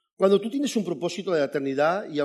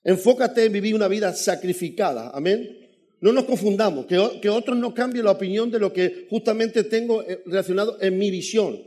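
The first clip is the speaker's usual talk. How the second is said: fervent sermon delivery, raised voice; preaching; 3 semitones higher, 5 dB louder than usual. Acted. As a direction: preach it to the congregation with conviction. Pastor preaching to congregation.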